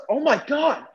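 high-pitched retold astonishment; inner screaming. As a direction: let the shock jump up in pitch, without yelling. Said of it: "I was screaming inside".

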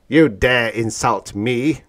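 slow, loud, affronted growl; growled outrage. A narrator's reading. Tanub challenging Orn, growled outrage. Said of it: "growled Tanub".

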